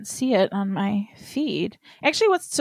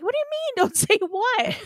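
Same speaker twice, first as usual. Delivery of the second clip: much higher, incredulous retelling; baffled retort. Retold or imitated. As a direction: throw the question back in mock disbelief. Acting out argument with family.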